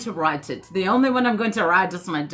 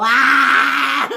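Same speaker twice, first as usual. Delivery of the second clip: excited yelp; 4 semitones higher, 7 dB louder than usual. Real, not acted.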